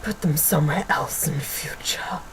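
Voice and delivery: low, hushed whisper; imitated teacher order. Imitating teacher telling pupil to remove wristbands, low whispered order. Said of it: "And he was like".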